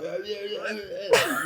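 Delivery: mock nonstop babbling; mumble-along imitation of a chatterer; rambling guy imitation. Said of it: "he just kept talking to me".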